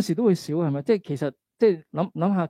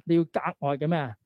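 ordinary delivery

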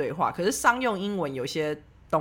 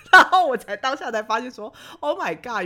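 laughing; 5 semitones higher, 5 dB louder than usual. Real, not acted. Realizes her accent, laughs.

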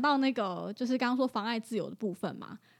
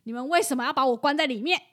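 louder, faster indignant complaint; hammed-up outraged question; mock angry quote; 3 semitones higher, 8 dB louder, faster than usual. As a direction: play the furious owner demanding why he's locked in. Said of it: "他就很生气哦".

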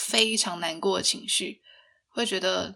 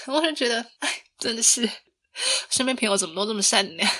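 ordinary talk

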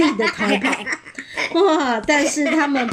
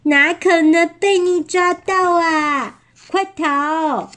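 higher-pitched playful taunt; lively, raised storybook getaway voice; taunting runaway; 6 semitones higher, slower than usual. Acted. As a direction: tease the chaser while making your escape. Fleeing wolves taunt the chaser.

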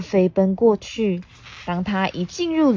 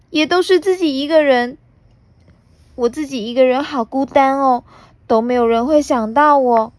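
crying plea; 9 semitones higher, 4 dB louder than usual. Acted. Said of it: "普娜哭喊着".

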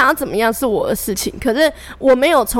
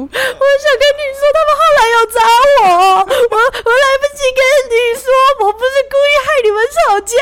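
much higher, teary mock sobbing plea; mock crying girl; 13 semitones higher, 5 dB louder than usual. Girl phoning the speaker in tears, mock teary much higher voice. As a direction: imitate her tearful apology in a much higher voice. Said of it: "一直哭".

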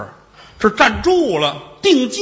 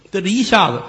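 usual speaking voice

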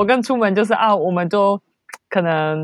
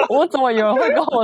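laughing; 6 semitones higher than usual. Retold or imitated. Amazed at being praised for English.

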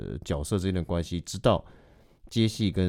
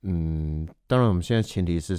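normal talking voice

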